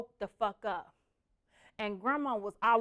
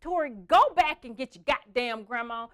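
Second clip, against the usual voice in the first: louder, bossy command; loud order; 3 semitones higher, 10 dB louder than usual. Retold or imitated. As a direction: order him back, loudly. Ordering brother back.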